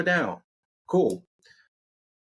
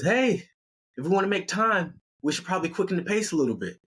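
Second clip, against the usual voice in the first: Amy voice; 8 semitones higher, faster than usual. Acted.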